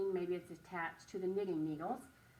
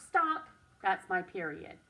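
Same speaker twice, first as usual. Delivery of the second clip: normal talking voice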